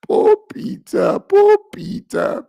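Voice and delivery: very high, slow fake wail; mock crying. Host pretends to weep for poor Peter, mock crying. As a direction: bawl it out in hammed-up sobs, drawing out each word. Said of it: "I'm sorry for you".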